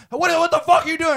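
mock road-rage outburst; retold yelling. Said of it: "and they start yelling".

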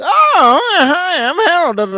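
hammed-up high weirdo voice, excited to meet her; Harold's weird voice. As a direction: squeal it like an awkward weirdo introducing himself.

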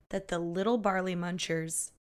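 normal talking voice

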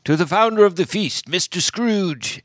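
mock toast, stretching out Mister Scrooge; Bob Cratchit toast. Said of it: "Bob Cratchit is the one who's like".

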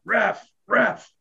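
high, carrying call for the ref; mock yell at the referee; imitating a yelling coach. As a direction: mimic the coach hollering across the rink. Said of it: "Brof start yelling out".

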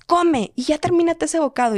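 a high, pushy nag from mom; mother nagging. Retold or imitated. Mom hurrying her daughter to eat, high nagging. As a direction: push the child to finish the bite.

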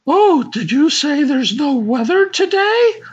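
high, puzzled lady; high woman voice. Acted. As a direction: ask it like a confused lady. Woman voice asking back.

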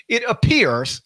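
"appears" drawn out and weighted; stress on appears. Shown as a demonstration. Host cautions listeners, stressing "appears". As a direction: lean hard on "appears" as a caution. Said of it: "I can't emphasize the word appears".

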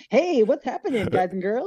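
breezy radio host hello. Retold or imitated. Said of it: "sounds like a D J".

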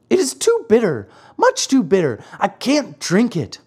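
high, whiny puppet protest; fussy Pinocchio refusing medicine; childish complaint. A narrator's reading. Pinocchio balks at bitter medicine.

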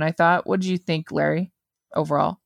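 usual speaking voice

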